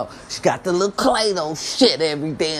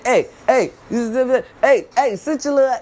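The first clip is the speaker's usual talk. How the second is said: put-on louder, higher hey-hey yelling at kids; mock screaming; 7 semitones higher, 5 dB louder than usual. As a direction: bark at jumping children to sit. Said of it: "I'ma start screaming".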